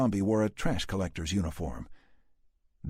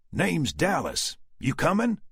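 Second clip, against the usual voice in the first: slow, high Southern cowboy drawl; 11 semitones higher, 4 dB louder than usual. A narrator's reading. Dallas offering a ride, Southern drawl. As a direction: drawl it out like a Texan stranger. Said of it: "unmistakable southern drawl".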